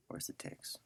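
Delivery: unvoiced whisper while searching; soft muttered whisper; whispered to himself. Real, not acted. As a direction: ask it under your breath, to no one. Searching the session under his breath.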